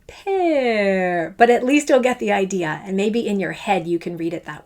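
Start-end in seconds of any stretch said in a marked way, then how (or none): 0.1-1.4 hammed-up, overly long "Pear"; ridiculously long pear. Shown as a demonstration. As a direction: stretch "Pear" out absurdly long. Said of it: "I'm gonna make it maybe a little ridiculous sounding".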